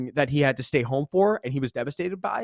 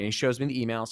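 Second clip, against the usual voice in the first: ordinary delivery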